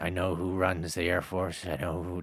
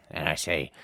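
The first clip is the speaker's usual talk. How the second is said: ordinary talk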